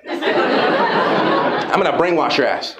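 slow low teasing threat; playful menace. Acted. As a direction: drag it out with a laugh. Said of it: "playful threat".

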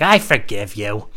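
drawn-out acted reply; mock forgiveness. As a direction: stretch it out slowly in mock concession.

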